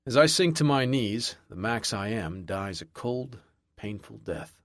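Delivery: usual speaking voice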